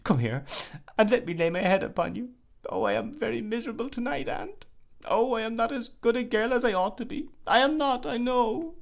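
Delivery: very high, wailing tearful voice; miserable sobbing. Acted. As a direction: weep out her misery to her aunt. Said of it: "sobbed Emily".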